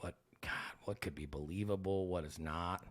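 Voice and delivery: low, soft thinking aloud; hushed musing. Retold or imitated. Prankster working out what is believable, hushed musing. Acting out himself pondering a prank.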